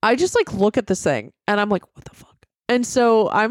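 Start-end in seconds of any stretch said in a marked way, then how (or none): 2.0-2.4 barely audible, unvoiced whisper; whispered disbelief. Looking at the shadow in the story, whispered disbelief. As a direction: whisper it to yourself, stunned.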